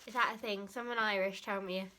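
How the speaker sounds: ordinary talk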